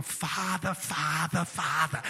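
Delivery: low, imitated Long Island accent. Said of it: "that Long Island accent".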